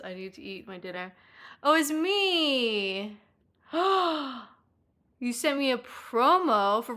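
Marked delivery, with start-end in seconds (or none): 1.6-4.5 drawn-out, loud, high gasp of joy; mock cry of delight. Real, not acted. Streamer thanking chat for a promo, hammed-up sob. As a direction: overdo the touched reaction.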